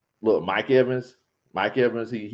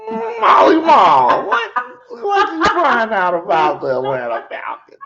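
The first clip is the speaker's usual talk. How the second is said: high, loud, slow mock yell; mock owner voice; 7 semitones higher, 8 dB louder than usual. The owner calling his spy on the phone, in a loud hammed-up yell. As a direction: ham up a shouting boss on the phone.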